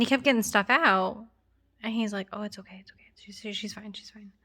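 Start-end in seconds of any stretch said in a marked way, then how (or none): 2.3-4.3 hushed, soothing whisper; calm the room with a whisper; whispering she's fine to his wife; whispered reassurance. Retold or imitated. Husband reassuring during emergency.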